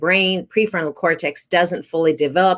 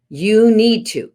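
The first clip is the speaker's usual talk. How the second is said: commanding you need to; 5 semitones higher, 4 dB louder, slower than usual. Shown as a demonstration. Parent ordering a child to do chores, stern command. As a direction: stress on "You", louder and higher, falling firmly. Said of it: "So for example".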